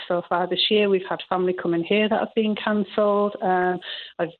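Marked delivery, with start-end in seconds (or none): none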